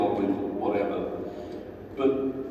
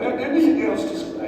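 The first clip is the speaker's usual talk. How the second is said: mock reporter; 12 semitones higher, 3 dB louder than usual. Holding phone out to cops.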